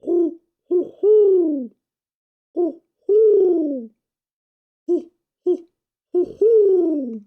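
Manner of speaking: high, far hooting owl calls; owl hoots. Shown as a demonstration. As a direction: hoot like an owl, again and again. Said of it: "harder than you think to go".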